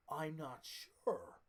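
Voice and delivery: slow, wooden dubbed delivery; bad English dub voice. Mocking the worst English dub, slow and wooden. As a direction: read it like a stilted voice actor dubbing a film. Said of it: "dubbed over by someone who can speak the worst English".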